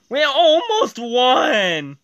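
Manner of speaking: high, slow wail of defeat; drawn-out, frustrated cry; dismayed yell. Real, not acted. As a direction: wail over the narrow loss. Just lost the race.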